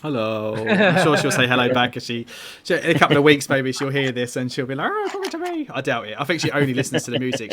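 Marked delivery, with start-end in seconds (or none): 4.9-5.7 mock child's delight. Daughter imagined reacting.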